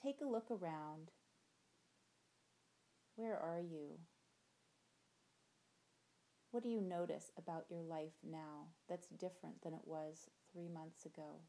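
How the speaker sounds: gentle visualization voice, soft endings; guided meditation. Acted. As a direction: guide them through the imagery calmly.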